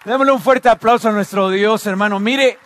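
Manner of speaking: loud, high call to the congregation; rally them for applause, then proclaim; impassioned pulpit cry; rousing preaching. Real, not acted.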